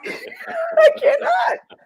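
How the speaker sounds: high, drawn-out laughing cry; can't stop laughing. Real, not acted. Laughing at her own story about Sally, real laughter.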